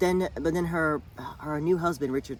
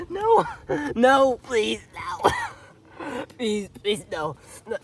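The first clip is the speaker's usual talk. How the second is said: much higher, louder shrieking no, please; hammed-up wailing pleas; 10 semitones higher, 7 dB louder, much slower than usual. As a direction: overdo a mother's desperate wail for laughs. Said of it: "this is gonna be ridiculous, watch this".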